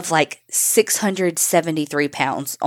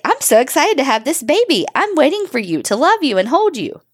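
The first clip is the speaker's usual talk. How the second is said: high, swooping singsong sweetness; mock gushing mom; 8 semitones higher than usual. Host mimicking the fake mom awaiting her baby, mock singsong gushing. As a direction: coo it mockingly, pitch looping up and down.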